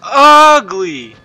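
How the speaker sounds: slow loud grossed-out shout; disgusted yell. Real, not acted. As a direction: yell it at the ugly monster. Monster appears on screen.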